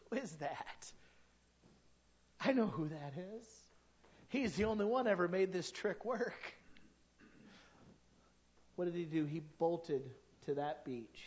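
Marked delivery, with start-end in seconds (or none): none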